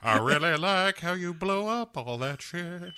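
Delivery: hammed-up Southern patriarch impression; Uncle Jesse. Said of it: "Uncle Jesse wanders up and he says".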